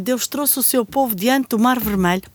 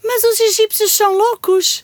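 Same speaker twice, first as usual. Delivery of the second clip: alarmed; 8 semitones higher, 3 dB louder than usual. Acted. Alarm at pursuers.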